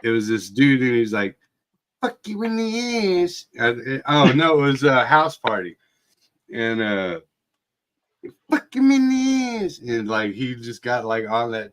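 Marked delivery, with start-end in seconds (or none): 2.0-3.5 high, strained imitation of a drunk bully; imitating the uncle's crude catchphrase; mock hillbilly taunt. 8.5-9.8 high, strained imitation of a drunk bully; imitating the uncle's crude catchphrase; mock hillbilly taunt.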